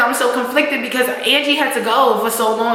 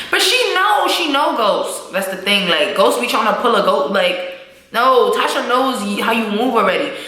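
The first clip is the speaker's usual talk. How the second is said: normal talking voice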